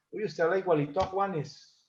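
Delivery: ordinary delivery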